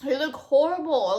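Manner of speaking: normal talking voice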